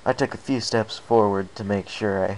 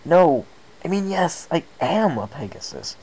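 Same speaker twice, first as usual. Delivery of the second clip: higher, louder flustered exclamation; exclaiming; 9 semitones higher, 5 dB louder than usual. Acted. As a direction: blurt it out in sudden realization. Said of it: "I exclaimed".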